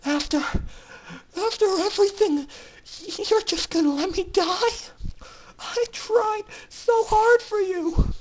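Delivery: high, breaking tearful plea; filly begs through tears not to be left to die; sobbing. Acted. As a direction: choke the words out through tears. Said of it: "Tears were pouring down her face now".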